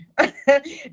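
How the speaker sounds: short loud nervous laugh; burst of laughter. Real, not acted. Promising viewers daily lives, a loud nervous laugh. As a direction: laugh it off, embarrassed.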